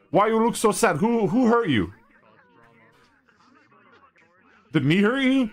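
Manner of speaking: put-on high cooing baby voice; baby talk. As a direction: coo at a sad pet. Talking to sad chat avatar.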